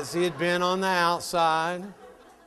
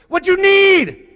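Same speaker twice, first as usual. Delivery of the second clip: put-on high, shouted Indian accent, curt; rude Indian man; 17 dB louder, slower than usual. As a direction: snap it like a gruff attendant over the intercom. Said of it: "this little Indian man goes".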